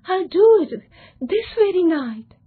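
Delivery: put-on eager young man swearing he'll do it tonight; determined. A narrator's reading. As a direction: promise it like nothing will stop you. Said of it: "said John with determination".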